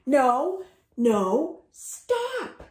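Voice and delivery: loud rising no, no, stop; protesting cry. Acted. Dill shouting as Busy paints him. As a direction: push back loud and desperate. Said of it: "said Dill".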